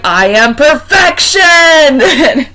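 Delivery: high, loud, slow laughing shout; mock grand proclamation. Man in the blanket declaring himself, a loud laughing shout. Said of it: "He was like".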